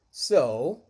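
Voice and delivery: ordinary talk, nothing marked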